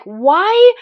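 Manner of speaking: exasperated at the stubborn kettlebell; exasperated outburst. Retold or imitated. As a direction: racer failing the kettlebell throw, exasperated. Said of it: "I just started getting so mad".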